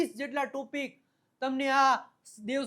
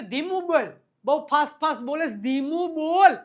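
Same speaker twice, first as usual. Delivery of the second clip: higher, louder mock protest; raised voice, drawing out the last words; acted loud complaint; 4 semitones higher, 9 dB louder than usual. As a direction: call out to a too-fast talker. Acting out telling a fast talker off.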